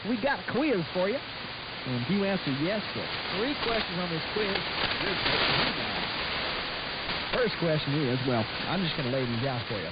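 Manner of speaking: ordinary talk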